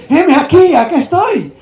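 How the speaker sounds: loud, high announcement of arrival; calling out. Acted. Boy answers the supposed summons again, calling out. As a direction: call out, keen to answer. Boy reports a third time.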